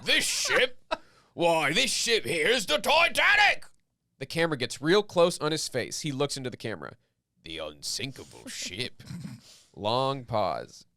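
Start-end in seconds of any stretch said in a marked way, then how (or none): 0.0-3.6 high, loud, laughing mock British cry; proud announcement. Pillow man proudly tells Jack the ship's name, in a high laughing shout. 7.4-9.0 low, soft, dramatic aside; a hushed hint of doom to camera; ominous aside. Pillow man murmurs to the camera in a low, soft ominous aside.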